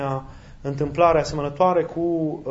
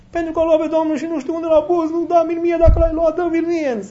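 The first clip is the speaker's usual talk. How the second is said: high, pleading voice of a woman in grief; grieving plea; 12 semitones higher, 4 dB louder than usual. Retold or imitated. Weeping Mary begs the gardener, high grieving plea. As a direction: beg through tears, voice lifted high. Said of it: "inimă plină de suferință și de durere".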